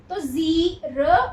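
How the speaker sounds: ज़ीरो drawn out slowly, long ई and long ओ; stretched long vowels. Shown as a demonstration. Teacher to class, slowly stretching ज़ीरो. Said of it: "दोनों में long sound आएगी".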